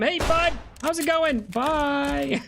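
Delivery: high, sing-song fake friendliness; mock-friendly taunt. Real, not acted. Player luring a Deathclaw, high sing-song taunt. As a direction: coax the monster sweetly, teasing.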